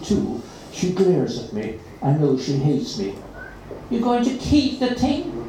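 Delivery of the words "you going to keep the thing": higher, sneering schoolgirl voice; a nasty girl sneers at the new cat; nasty girl asking. A narrator's reading. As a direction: look down on it as a thing. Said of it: "she asks".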